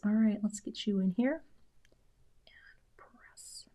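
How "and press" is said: very soft whisper while concentrating; real involuntary whisper. Real, not acted. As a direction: whisper under your breath as you work. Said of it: "Sometimes I whisper when I concentrate".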